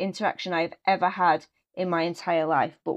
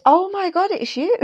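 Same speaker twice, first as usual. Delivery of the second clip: much higher, excited squeal of recognition; starstruck; 12 semitones higher, 4 dB louder than usual. Retold or imitated. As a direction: gush at meeting a famous manager. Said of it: "and I was like".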